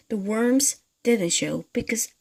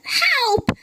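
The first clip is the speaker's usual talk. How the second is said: much higher, loud panicked shriek; scream; 7 dB louder than usual. Acted. Mrs Twit shrieking at the frog in her bed. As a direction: shriek for help in terror. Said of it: "screamed Missus Twit".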